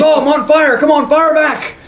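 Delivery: high, hyped-up retold challenge; goading taunt. Coach dares young punks to answer, goading. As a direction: egg them on gleefully.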